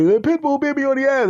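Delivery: high, loud outraged whine; high whiny complaint. Acted. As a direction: victim wailing to the doctor. Patient tells doctor.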